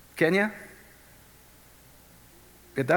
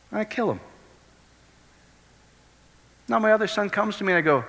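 usual speaking voice

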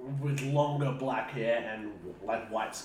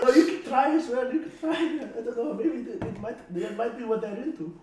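put-on female voice; 8 semitones higher than usual. Players speaking in disguise voices.

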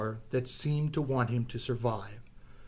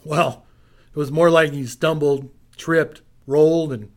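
louder, driving action read; intense action narration; 9 dB louder than usual. A narrator's reading. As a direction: build the chaos loud. Narrated chase climax.

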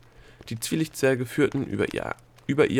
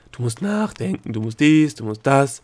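higher, loud singsong mimicry; mocking imitation; 4 semitones higher, 6 dB louder than usual. Delia aping the gnome's nagging, mocking. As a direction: parrot the nagging in mockery. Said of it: "mit deinem Du musst dich konzentrieren Gelaber".

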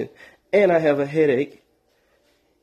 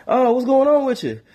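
high, loud pushy buddy; a chatty friend who wants to come over; eager friend calling; 11 semitones higher, 4 dB louder than usual. Retold or imitated. Friend calling to get studio time, high and loud. Caller voiced in phone story.

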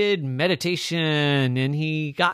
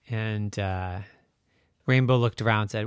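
usual speaking voice

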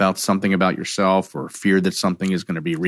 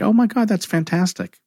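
much higher, bright exclamation; delighted; 13 semitones higher than usual. Acted. Coach cheering the upset caller, delighted higher exclamation. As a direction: surprise them with delight. Coach answering a triggered client.

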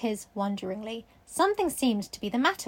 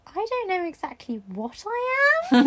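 put-on girl voice; 7 semitones higher, slower than usual. Sarah voiced slowly.

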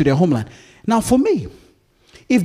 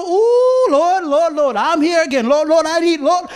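high, loud crying out to the Lord; desperate pleading prayer; 8 semitones higher, 5 dB louder than usual. Retold or imitated. Preacher acting a troubled believer crying out to the Lord, pleading. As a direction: imitate a troubled believer calling on God. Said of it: "But when I have trouble".

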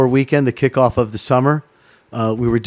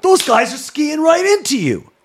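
panicked disbelief; 11 semitones higher than usual. Retold or imitated. Retelling his panic on the pyramid.

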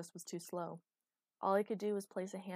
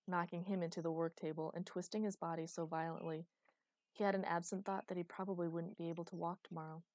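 plain reading voice

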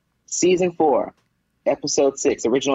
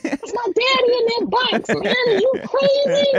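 squeaky, much higher mock indignation; mock drunk outrage. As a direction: play the sloppy drunk refusing to leave, voice up high. Drunk daughter defies funeral ejection.

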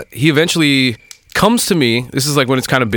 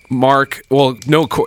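normal talking voice

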